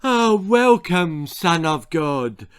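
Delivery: drawn-out acclaim, starting high and loud; cheering crowd. Acted. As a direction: hail him as a cheering crowd. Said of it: "They were all cheering".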